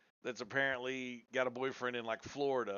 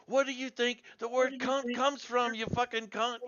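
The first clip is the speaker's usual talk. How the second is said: imitating raging woman; 11 semitones higher, 6 dB louder than usual. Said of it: "starts going m-, just nuts".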